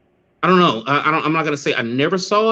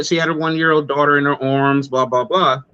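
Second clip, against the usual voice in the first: normal talking voice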